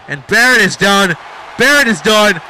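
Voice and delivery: emphatic, loud wrestling commentary; excited finisher call. Real, not acted. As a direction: punch out the move, stretching its name. Commentator calls finishing move.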